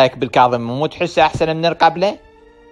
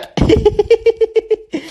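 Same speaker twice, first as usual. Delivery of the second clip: high giggle. Real, not acted. Child laughs high.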